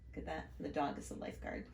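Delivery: quick whispered aside; hushed quick aside. Real, not acted. Aside about a picture.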